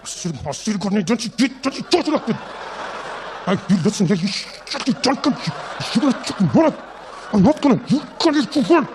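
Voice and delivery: lips barely moving threat; hissed parent threat. Retold or imitated. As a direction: scold through a fixed smile. Whispered ventriloquist parent fight.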